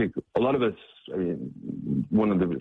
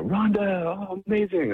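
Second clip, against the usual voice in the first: joyful welcome; 13 semitones higher than usual. Retold or imitated. Missionaries welcoming Rhonda back, joyful and high. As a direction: gush over seeing her again.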